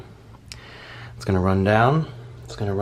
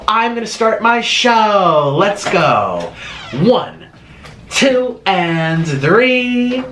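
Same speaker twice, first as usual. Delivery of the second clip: loud, high countdown to kick off the show; show-opening shout; 5 semitones higher, 4 dB louder, much slower than usual. Pretend. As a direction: belt it out slowly like a showman.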